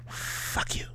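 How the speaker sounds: voiceless whisper; whispered fuck you. Retold or imitated. Reading a friend's angry text in a whisper. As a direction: breathe the insult out. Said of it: "So many text messages".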